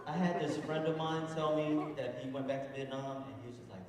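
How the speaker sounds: normal talking voice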